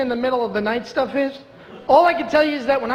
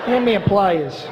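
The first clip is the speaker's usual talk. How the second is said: put-on Brooklyn accent; 2 semitones lower than usual. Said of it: "I grew up in Brooklyn".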